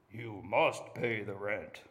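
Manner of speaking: low, slow menacing villain voice; mustache twirling villain. Shown as a demonstration. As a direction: growl it like a melodrama villain. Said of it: "So all the men say".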